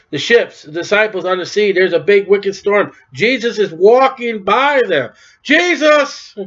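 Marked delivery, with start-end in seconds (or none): 5.4-6.3 high, urgent call of the name; desperate call. Acted. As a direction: cry out to him over the storm. Disciples calling from storm-tossed boat.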